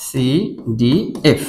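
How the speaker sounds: CDF spelled very slowly, ending on a rise; slow spelling out, rising. Shown as a demonstration. Teacher to students, slowly spelling with a questioning rise. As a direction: crawl through CDF and lift the end. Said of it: "এইটা মিল রয়েছে".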